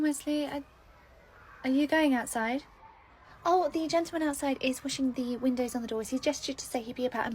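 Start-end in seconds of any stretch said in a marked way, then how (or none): none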